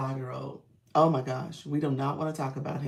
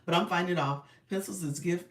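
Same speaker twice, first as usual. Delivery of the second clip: usual speaking voice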